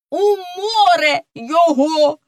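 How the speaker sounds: high-pitched rallying cry; shouted. Acted. Wolf brother demands he go overboard, shouting. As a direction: yell it like a wolf cub. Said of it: "закричав вовчик братик".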